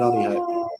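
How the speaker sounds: high echo. Pretend. Signing off the chat with a squeaky comic echo. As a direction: echo the line in a squeaky put-on voice. Echoes his line in a put-on voice.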